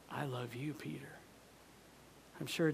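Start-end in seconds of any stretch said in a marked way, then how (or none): 0.1-1.2 very soft, tender; Jesus tenderly reassuring Peter; gentle Jesus. Acted. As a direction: say it softly as a reassurance.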